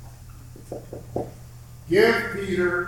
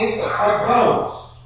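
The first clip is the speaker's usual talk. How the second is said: higher, drawn-out cry of mild fear; fearful cry; 5 semitones higher, 4 dB louder, much slower than usual. A narrator's reading. Disciples see a figure on the water, fearful cry. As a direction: cry out slowly, a touch of fright. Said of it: "In their fear, they cried out".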